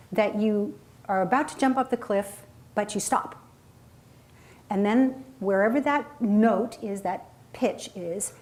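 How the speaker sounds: usual speaking voice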